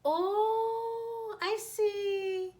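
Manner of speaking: high bright "Oh, I see" of sudden understanding; amused dawning surprise; exclaiming. Retold or imitated. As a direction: realise it all at once with a little laugh. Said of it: "she exclaims and slightly laughs".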